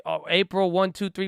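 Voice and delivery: normal talking voice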